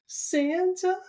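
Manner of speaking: high little girl question, lightly voiced; Lisa. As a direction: pitch up into a hopeful child's ask. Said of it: "she goes".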